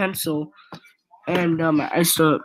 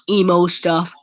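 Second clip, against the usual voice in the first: ordinary talk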